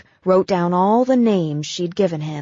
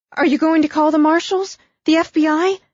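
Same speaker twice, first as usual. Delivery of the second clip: anxious, rushed; 9 semitones higher, 5 dB louder, faster than usual. Acted. Abigail panicking about marshals.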